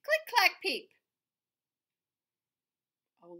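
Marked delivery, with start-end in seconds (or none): 0.0-0.9 1 title called out. A narrator's reading. Reading the book title aloud.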